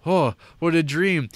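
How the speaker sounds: high relieved sigh of a line; hammed-up waking relief; mock relief. Lord waking from the nightmare, high mock relief. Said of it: "And he wakes up".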